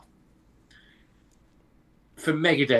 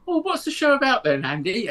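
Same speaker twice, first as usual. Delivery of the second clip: lightly imitated parent, higher pitch; parent's curious question; 7 semitones higher than usual. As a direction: pitch it up like a mum asking. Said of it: "they go".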